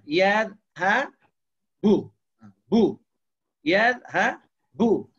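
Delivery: "Yadhhabu" broken syllable by syllable, loud BU at the end; very slow "Yadhhabu", punching the last syllable bu; final BU stressed. Shown as a demonstration. Teacher showing the clear dhammah ending, stressed BU in "Yadhhabu". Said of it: "Dhammah yang jelas di akhir".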